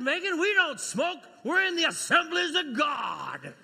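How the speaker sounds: loud, high mock-stern shout with a laugh; hammed-up father scolding, laughing through it. Father mock-scolding his daughter in a loud, laughing shout.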